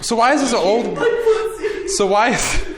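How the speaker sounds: giggling through the words; cracking up mid-sentence; laughing. Real, not acted.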